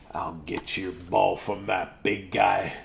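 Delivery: low, slow, soft brave elephant; heroic Gerald. Acted. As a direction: promise it slowly and low.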